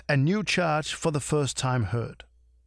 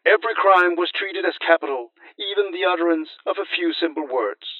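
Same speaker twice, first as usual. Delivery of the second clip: louder, solemn reading of Tacitus; Roman historian quote; 2 semitones higher, 7 dB louder than usual. Acted. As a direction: read it like an ancient chronicler. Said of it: "Tacitus writes".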